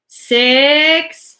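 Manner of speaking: high, loud count. A narrator's reading. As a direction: keep counting threateningly. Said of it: "said the voice".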